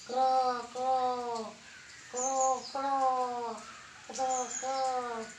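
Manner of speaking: drawn-out croaks in pairs; imitated croaking calls; animal croak imitation. Shown as a demonstration. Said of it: "going to imitate sounds of some animal".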